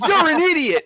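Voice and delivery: high, loud jab through laughter; laughing mock insult. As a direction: toss the insult while laughing. Retelling celebrity's insult.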